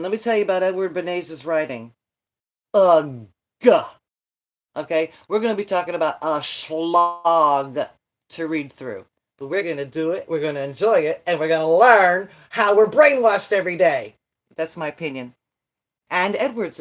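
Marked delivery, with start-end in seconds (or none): 2.7-3.3 loud fed-up ugh; loud disgusted groan. Real, not acted. Groan about dreaded book. 9.5-14.1 loud, hammed-up announcement; mock rally cry.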